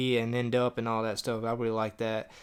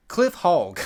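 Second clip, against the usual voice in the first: "Cliff Hogg" punched higher and louder; full name, emphasized; 4 semitones higher, 5 dB louder than usual. Shown as a demonstration. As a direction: relish saying "Cliff Hogg". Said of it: "You gotta say his last name".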